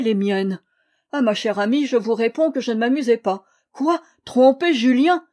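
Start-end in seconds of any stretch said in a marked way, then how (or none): 3.7-5.3 shocked outcry. Acted. Narrator's indignant inner outcry.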